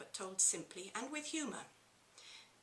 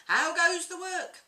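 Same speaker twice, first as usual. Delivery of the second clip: high, loud lazy farmer's call; loud bellowing farmer; 9 semitones higher, 14 dB louder than usual. Acted. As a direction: bellow it from bed at the duck. Farmer calls, louder each time.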